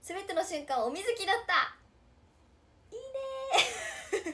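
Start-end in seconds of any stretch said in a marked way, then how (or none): 2.9-4.3 delighted drawn-out cheer. Real, not acted. Fan's pun on her name.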